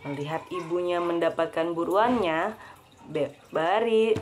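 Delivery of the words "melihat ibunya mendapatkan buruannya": plain reading voice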